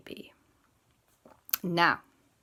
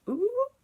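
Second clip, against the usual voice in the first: very high, rising little hoots; perking ears noise. Shown as a demonstration. Showing how bear ears move, high perky noise. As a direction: squeak ears popping up. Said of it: "They're kinda like this".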